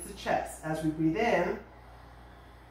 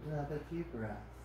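soft, calm body-scan voice; guided meditation; 5 semitones lower, 10 dB quieter than usual. Acted. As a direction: ease them into rest, unhurried and soft. Leads a relaxation body scan.

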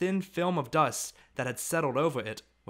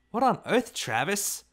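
startled mother; 8 semitones higher, 3 dB louder than usual. A narrator's reading. Mama startled by the racket.

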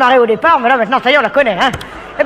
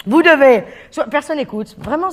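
ordinary delivery

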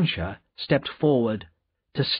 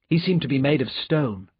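ordinary delivery, nothing marked

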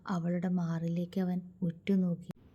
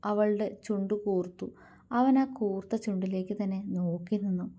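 usual speaking voice

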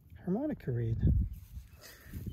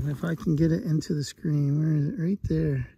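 ordinary talk

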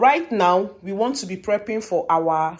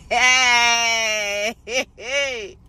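gleeful shouts; 2 semitones higher, much slower than usual. Real, not acted. Celebrating destroyed card, laughing.

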